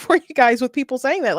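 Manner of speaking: ordinary talk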